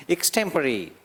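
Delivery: एक्सटेम्परी said as ik-STEM-pari, not extempo; teacher's pronunciation. Shown as a demonstration. Teacher models the word एक्सटेम्परी for the class. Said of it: "इक से शुरू करते हैं, स्टेम, और last में परी बोलते हैं".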